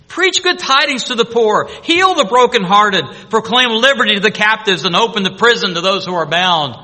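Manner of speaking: loud, high, ringing preaching; forceful scripture proclamation. Acted. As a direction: proclaim each promise at full force.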